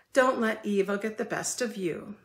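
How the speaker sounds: ordinary talk, nothing marked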